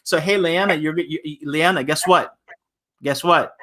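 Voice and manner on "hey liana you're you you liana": usual speaking voice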